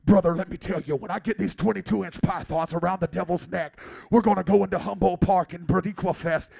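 loud, hyped-up wrestler-style preacher impression; hammed-up full-force fighting promo; Pastor Joe. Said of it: "So that is like Pastor Joe to me".

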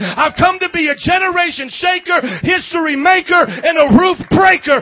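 shouted, rising sermon climax; full-force preaching. Acted. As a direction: preach it out loud to the whole room.

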